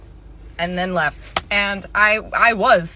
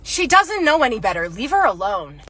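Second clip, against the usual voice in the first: the mother; 3 semitones higher, 3 dB louder than usual. Acted. Mother defending her child to the worker, indignant. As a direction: act out an indignant mom telling someone off. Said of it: "This is what she did".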